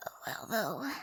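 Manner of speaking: low whispered gremlin croak. Acted. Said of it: "I'm trying to do gremlin".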